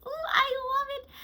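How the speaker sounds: high, squealing delight. Real, not acted. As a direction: squeal with pleasure. Tasting the drink delighted.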